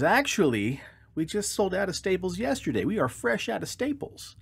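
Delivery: smugly sure the duck is beaten; apologetic shopkeeper. Acted. Manager apologetic.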